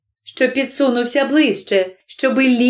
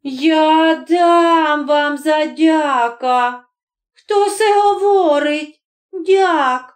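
high, drawn-out outrage; acted indignant scolding; threatening wife; 5 semitones higher, much slower than usual. A narrator's reading. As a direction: demand answers in a raised, stretched voice. Deacon's wife joins the quarrel.

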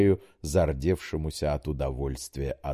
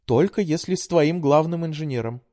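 put-on high, pleading young woman; daughter voice; 11 semitones higher, 4 dB louder than usual. A narrator's reading. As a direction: coax like a sweet daughter. Daughter voice held.

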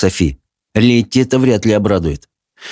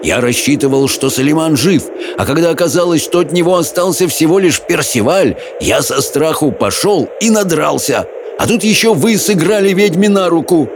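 heated tirade, rising higher; shouting on; 7 semitones higher than usual. A narrator's reading. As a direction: build the tirade to a high cry. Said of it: "крикнул Хоул". (горячая тирада на повышенных тонах)

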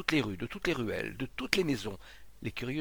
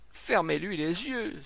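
high, slow, loud supplication; Monsieur Rodiguet pleading; 7 semitones higher, 5 dB louder, slower than usual. A narrator's reading. Distraught man imploring the crowd, pleading. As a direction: beg them, stretching the words. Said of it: "supplia monsieur Rodiguet".

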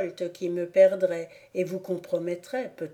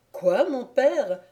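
higher, slow, amazed outburst; naive surprised cry; 5 semitones higher, slower than usual. A narrator's reading. Son cries out to his father, naively amazed. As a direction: let it escape, wide-eyed and innocent. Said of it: "S'écria Évrard, emporté par la naïveté de son cœur".

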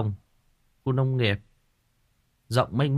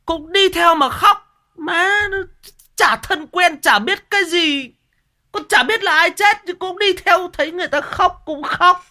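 very high, loud, whiny telling; mock wailing retelling; 9 dB louder than usual. Storyteller on kids crying for strangers' funerals, mock tearful wail.

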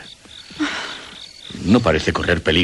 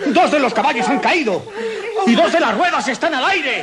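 high, urgent calls for help; rally everyone to the crash; high-pitched urgent shouting; urgent calling out. Acted. Carriage overturns, father calls for help.

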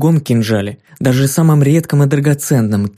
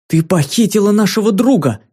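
higher, outraged accusation; indignant cry; 7 semitones higher than usual. A narrator's reading. Ki accusing the giantess of kidnapping her friend, outraged higher cry. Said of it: "возмутилась Ки".

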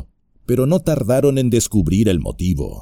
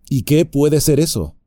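ordinary delivery

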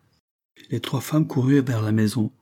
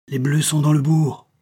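mildly alarmed cry, higher pitched; 6 semitones higher, 4 dB louder than usual. A narrator's reading. Mother to daughter as gunfire breaks out, crying out. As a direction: call out the news, raised but contained. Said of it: "s'écria la Réveillère".

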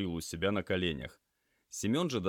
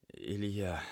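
weak, halting croak; faint, broken-up words; hoarse voice. A narrator's reading. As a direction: plead feebly, as an injured patient. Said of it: "парень хриплым голосом".

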